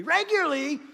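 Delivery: "regularly" swooped up high and stretched out loud; exaggerated REGULARLY. Shown as a demonstration. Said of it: "you gotta say it like this".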